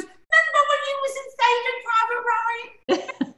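high, slow put-on young Harry voice; mock [name]. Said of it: "he goes".

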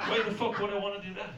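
usual speaking voice

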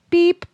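high, loud electronic beep; mock phone beep, sharp and high; call waiting beep. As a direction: chirp it like a phone tone.

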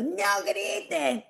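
high, drawn-out mock shout; hammed-up shriek, stretched out; automatic yelling. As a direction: blurt out an exaggerated parental yell.

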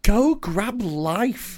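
mocking quote. Friend quoting the ex's advice, mocking. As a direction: stretch each word out in disbelief.

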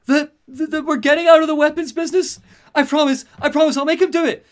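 loud, very high frightened voice; panicked pleading. Acted. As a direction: plead desperately, stammering at the start. Acted Falcone pleading with Penguin.

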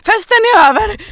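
a hammed-up party-is-over call; laughing mock announcement. Host jokingly ends the party, laughing loudly.

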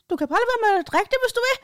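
placating high-pitched sweetness; sugary high voice. Retold or imitated. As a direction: coo it high like calming a child. Speaker soothing the woman.

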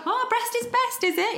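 very high, sarcastic squeal; mock sarcastic. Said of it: "like a real kind of rant".

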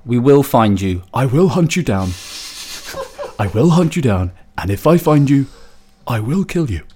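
hammed-up movie villain vow; menacing threat. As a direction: overdo the hunter's threat like a movie tough guy. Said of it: "I've gotta stop saying that. I'm really overdoing it".

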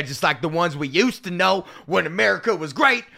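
mock Southern carol lyric; put-on Southern voice, building to the last word; Southern accent. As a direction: keep up the hammed-up Southern accent. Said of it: "with a southern accent".

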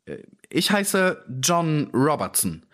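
evasive, drawn-out little-man voice; hesitant lying. A narrator's reading. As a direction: feel your way through a made-up name. Man hesitantly gives a false name.

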